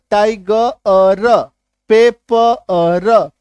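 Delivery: टाइगर and पेपर very slowly, the ending split into अ and र; slow syllable-by-syllable demo of टाइगर, पेपर; sounded out. Shown as a demonstration. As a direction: stretch टाइगर and पेपर, separating the final sounds. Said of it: "अ, ई जो है वो क्या बन गया".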